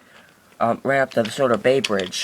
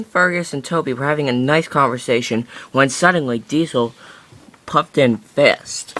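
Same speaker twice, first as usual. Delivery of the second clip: usual speaking voice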